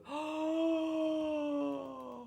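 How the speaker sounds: sharp delighted gasp; excited gasp. Real, not acted. Streamer hears a wild theory, gasping in awe. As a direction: suck in breath in amazement.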